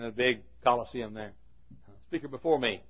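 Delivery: ordinary delivery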